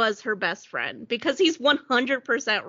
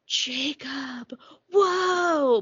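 stretched, hammed-up shock; wedding guests stunned by a surprise arrival, overacted; mock astonishment; 3 semitones higher, much slower than usual. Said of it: "everyone's like".

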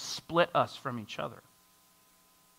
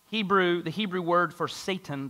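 ordinary delivery

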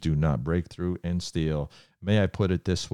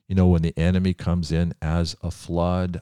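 ordinary talk

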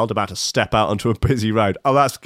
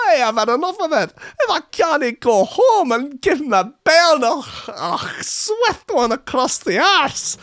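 very high-pitched, loony mock-Scottish rant; terrible Scottish accent. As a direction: wail it out in a hammed-up Scottish accent. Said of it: "this is the worst Scottish accent ever".

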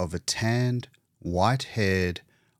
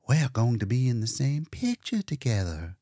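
usual speaking voice

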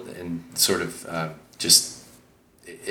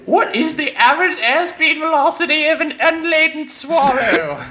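very high, louder, hammed-up British accent; horrible British accent; 5 dB louder than usual. Host quotes Monty Python for his co-host, horrible British accent. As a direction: squeal it as a bad Monty Python Brit. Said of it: "attempt a horrible British accent".

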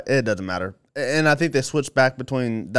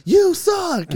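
high, drawn-out heckling yell; yelled heckle; 4 dB louder than usual. Retold or imitated. Retelling her heckle at a wrestler, yelling. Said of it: "I don't know which one I yelled at".

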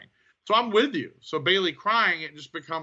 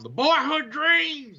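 much higher, slower, tearful mock cry; Shawn Michaels crying; 12 semitones higher, 3 dB louder than usual. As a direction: imitate a wrestler breaking down in tears. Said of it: "Like Shawn Michaels breaking down".

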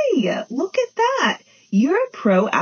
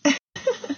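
a small, soft laugh; a beaver's quiet, amused chuckle; 5 dB quieter than usual. A narrator's reading. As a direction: give a gentle little laugh. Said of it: "Beaver Budgie laughed".

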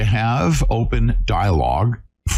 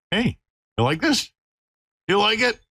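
high, sing-song puppy talk; 10 semitones higher than usual. Real, not acted. Cooing at the puppy.

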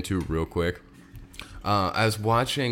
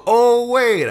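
excited; 13 semitones higher, 9 dB louder than usual. Real, not acted. Sudden realization.

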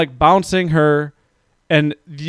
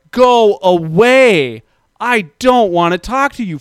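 high, slow shouted go away; retold yell; 5 semitones higher, 4 dB louder than usual. Mother yelling at the old lady at her window. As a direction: yell through the glass to drive her off. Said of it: "I was yelling through my window".

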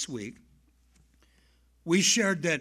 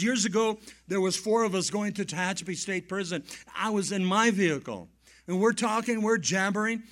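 ordinary delivery

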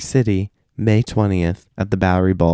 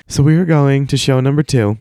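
ordinary talk, nothing marked

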